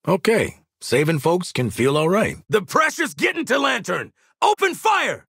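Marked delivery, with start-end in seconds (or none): 2.5-5.2 high, urgent combat call; battle shout. Acted. Calling the squad to fire on Lantern, urgent shout. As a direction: shout the order over a firefight.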